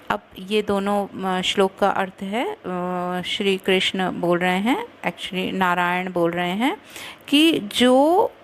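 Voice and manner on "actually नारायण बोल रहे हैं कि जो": usual speaking voice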